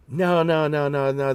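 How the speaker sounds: mock insistent refusal; emphatic denial. Retold denial of eating it.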